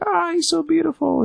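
very high mock fangirl sigh; swooning girls. Girls admire the superhero, high mock swoon. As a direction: swoon like a smitten fan, way up high.

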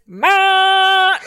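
loud high honk voiced as Ma; imitated bus honk. Mother imitating the bus honking behind her. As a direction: blare it like a horn.

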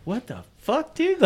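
high-pitched, baffled reaction; mock confusion. Storyteller replaying reaction to the ice pack request, mock confusion. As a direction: pitch it up in puzzled disbelief.

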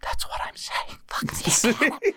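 hushed whisper of protest. Acted. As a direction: whisper it like a secret grudge. Mock-bitter rant about Oscars.

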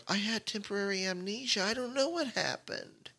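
high, soft innocent voice, playing dumb. Wife feigning amnesia to the police, high and soft, playing dumb. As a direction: act clueless in a high, soft little voice. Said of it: "playing dumb somehow".

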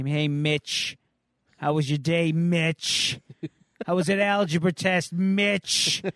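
mom's questions in a lightly voiced sing-song mock; a bored kid sneering at how his mother sounds; teen mocking his mom. Kid imitating his nagging mom with mild mockery. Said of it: "I can just hear my mom now asking me".

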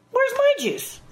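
very high, put-on little voice; high mock demand. Actress re-enacts demanding juice at the reading, high-pitched. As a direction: pipe it up far above normal. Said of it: "I open my eyes and go".

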